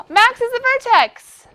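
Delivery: very high, loud, drawn-out excitement; hammed-up revelation. Teacher revealing the vertex to class, hammed-up excitement. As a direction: squeal it like an amazing discovery.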